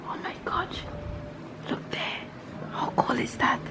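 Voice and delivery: hushed, very soft real whisper; awed whisper. Real, not acted. Mother marveling inside the old castle, awed whisper. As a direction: whisper in wonder at what you see.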